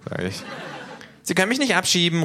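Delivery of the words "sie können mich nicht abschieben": high, loud protest; kangaroo calling out. Acted. Kangaroo objecting to deportation, high loud call. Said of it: "ruft das Känguru".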